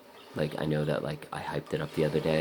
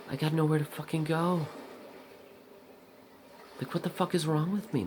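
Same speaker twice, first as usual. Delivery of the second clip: much higher, pitched-up frustrated cry; exasperated outburst; 12 semitones higher than usual. Retold or imitated. Catching his anxious thoughts on the bathroom walk, exasperated high outburst. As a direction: throw up your hands at yourself. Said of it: "I'm like".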